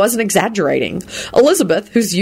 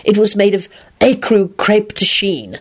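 higher-pitched, showy dramatic air; dramatic recitation; 4 semitones higher than usual. Acted. Lupin reciting her dress description, dramatically. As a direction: announce the fabric with mock grandeur. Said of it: "put on a dramatic air".

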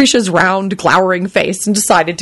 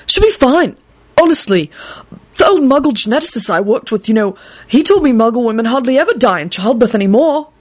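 frightened; 5 semitones higher than usual. Acted. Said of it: "in a frightened voice".

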